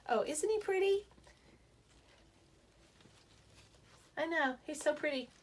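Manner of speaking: high, gushing coo over something pretty; cooing over a plant. Real, not acted. Showing off her new houseplant to viewers, gushing coo.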